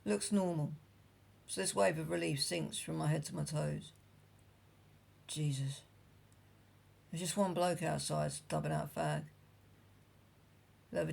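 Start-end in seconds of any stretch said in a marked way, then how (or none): 5.3-5.8 whispered. A narrator's reading. Relief after fleeing the pub.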